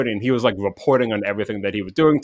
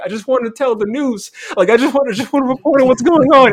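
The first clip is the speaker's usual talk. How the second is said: much higher and louder, a panicked shout; retold shouting; 12 semitones higher, 5 dB louder than usual. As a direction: yell it over gunfire like a desperate reporter. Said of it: "was just shouting".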